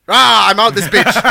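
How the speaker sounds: slow, loud put-on voice bailing out; mock villain bailing. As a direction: growl it as you flee. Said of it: "he's just like".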